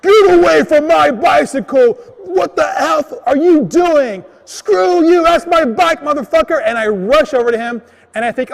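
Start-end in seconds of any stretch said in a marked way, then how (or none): 0.0-6.6 loud, high-pitched angry dad yell; angry, scary dad voice. Retold or imitated. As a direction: bellow like a scary dad chasing off a thief. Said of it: "in my best angry, scary dad voice".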